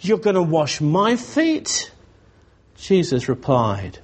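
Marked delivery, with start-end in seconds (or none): 0.0-1.9 high, disbelieving question, climbing to "feet"; appalled, pitched-up protest; Peter's shocked horror. Acted. Peter to Jesus over washing his feet, shocked horror. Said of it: "the tone of horror in what Peter is saying".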